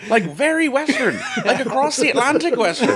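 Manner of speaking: mock Irish accent. Host teasing John in a mock Irish accent. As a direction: put on a jokey stage-Irish voice. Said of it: "I'm not doing this voice".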